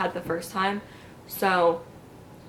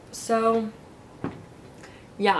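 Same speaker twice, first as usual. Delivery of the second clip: normal talking voice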